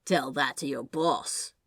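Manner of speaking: a touch of bravado over nerves. Acted. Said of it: "sounding much braver than he currently felt".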